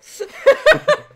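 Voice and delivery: high, loud mocking cackle; a shrill snooty laugh; haughty laugh. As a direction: groundskeeper laughing at the lawyers.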